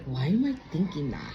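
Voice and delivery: low, soft puzzled musing; a low, soft inner question. Retold or imitated. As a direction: wonder it quietly to yourself. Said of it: "And it wasn't like".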